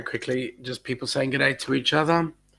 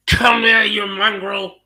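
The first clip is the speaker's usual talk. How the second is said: loud, higher-pitched gruff granny; grandmother voice; 7 semitones higher, 4 dB louder, slower than usual. Retold or imitated. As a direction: bark it like a rough old nan. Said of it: "My grandmother used to grab".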